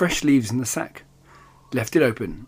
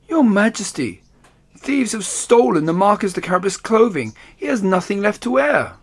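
higher, urgent pleading tone; distressed courtier; 7 semitones higher than usual. Acted. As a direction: plead with the king, upset. Cat pleading to king.

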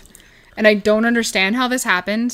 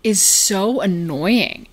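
annoyed judging; much slower than usual. Retold or imitated. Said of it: "That female's voice".

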